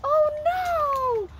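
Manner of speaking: high-pitched pretend dismay; hammed-up alarm for a toddler; playful mock alarm. Playacting toy stuck on barn.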